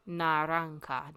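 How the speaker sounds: Naranja with the j as a breathy H; pron. Shown as a demonstration. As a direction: say Naranja with j like an English H. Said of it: "J sounds like an H".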